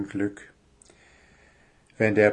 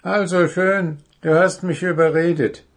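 higher, louder crow voice, brisk; crow giving in; 7 semitones higher, 4 dB louder than usual. Acted. Crow tells dwarfs it will fly for help. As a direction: crow agreeing to fetch help. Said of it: "sagte die Krähe".

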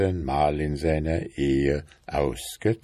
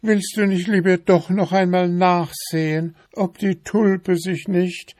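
high-pitched, fussy wife voice, louder; nagging wife beetle; 13 semitones higher, 5 dB louder than usual. A narrator's reading. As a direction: pester him in a high, insistent voice. Wife pesters again.

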